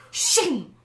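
sword being drawn. Pretend. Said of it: "while I say it, I wanna be like".

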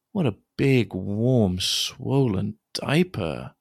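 usual speaking voice

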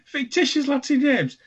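high mocking "fictitious Latin names"; teasing scorn. Real, not acted. Mock scorn repeat.

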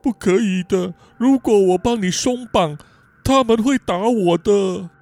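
put-on tearful, childlike refusal, dragging out the end; whimpering Lawrence. Little brother afraid of a beating, tearful whine. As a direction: whine it as if about to cry. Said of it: "Lawrence哭丧着脸说".

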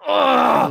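a loud, high mock groan of defeat; frustrated groan. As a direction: groan like a guilted kid. Retold groan at mom's guilt.

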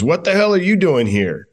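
mock indignation, pitched well up; imitated indignant manager. Manager finds the rookie in the locker room, mock indignant.

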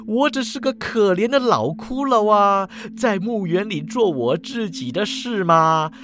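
indignant skeleton, high and drawn-out; self-righteous protest. A narrator's reading. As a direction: plead your innocence loudly, hammed-up and aggrieved. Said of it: "骷髅还跟我理直气壮啊". (理直气壮地喊冤，夸张拖腔)

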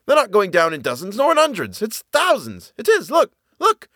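high-pitched, amazed calling out; excited bellow. A narrator's reading. Soldier to his mate as the enemy falls, bellowing. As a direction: point out the marvel over the din of firing. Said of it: "he bellowed to the man next to him".

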